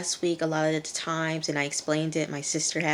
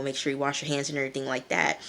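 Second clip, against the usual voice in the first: normal talking voice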